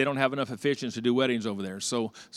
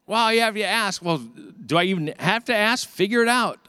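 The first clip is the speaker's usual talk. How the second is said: high, raised-voice retorts; heated bickering; 7 semitones higher, 3 dB louder than usual. Retold or imitated. Husband and wife quarreling over the TV, heated retelling. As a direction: argue back, exasperated.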